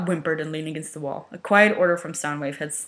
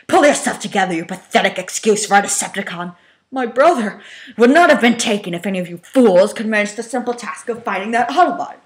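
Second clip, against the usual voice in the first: louder, higher contemptuous tirade; furious berating; 5 semitones higher, 8 dB louder than usual. Acted. Megatron berating the frantic Runabout. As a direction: tear into him with scorn.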